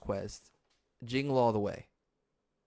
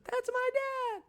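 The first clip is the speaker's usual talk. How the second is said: put-on very high childish voice; kid quote; slower than usual. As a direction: squeak it like an excited kid. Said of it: "my favorite Christmas scene".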